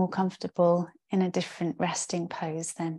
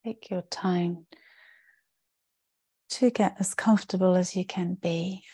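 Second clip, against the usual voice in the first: guided meditation. Real, not acted. Yoga teacher settling the class into rest, calm guided-meditation voice. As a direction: guide them into rest, with spacious pauses between phrases. Leads a yoga nidra practice.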